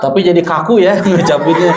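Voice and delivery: laughing through the words; amused laugh. Real, not acted. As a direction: chuckle while saying it.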